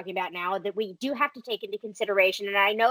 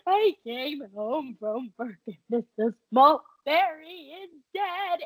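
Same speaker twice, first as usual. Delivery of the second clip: slow, half-crying wail; hammed-up crying retelling; mock sobbing; 4 semitones higher, 3 dB louder, slower than usual. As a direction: blubber it like a heartbroken kid. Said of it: "I walked in crying".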